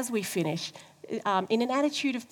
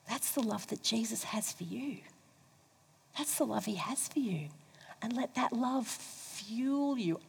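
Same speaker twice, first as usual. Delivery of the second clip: hushed tender; 8 dB quieter than usual. Real, not acted. Tender close of sermon.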